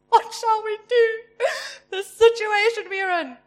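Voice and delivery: high, loud hammed-up panicky wail; mock panic. Preacher mimicking a panicked believer, hammed-up crying wail. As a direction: play the frightened servant, overdone.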